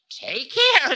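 high, loud, sharp warning. A narrator's reading. Time warning the Demon King, sharp. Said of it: "said Time sharply".

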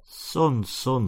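a mildly spluttered start, words tripping; spluttering. A narrator's reading. Dad flustered as his son questions him, light splutter. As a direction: sputter the words out a little. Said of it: "spluttered Gary's dad".

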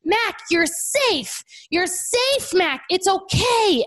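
put-on high child voice, slow and soothing; calm the crying baby like a little sister would; imitating comforting toddler. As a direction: toddler soothing her crying brother in the car. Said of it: "she's like".

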